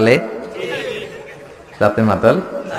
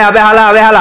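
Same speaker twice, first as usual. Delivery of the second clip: put-on loud, higher Old Dhaka "আবে হালা"; Old Dhaka slang; 6 semitones higher, 6 dB louder than usual. People of Old Dhaka greet, loud higher Old Dhaka slang. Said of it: "পুরান ঢাকায় যান".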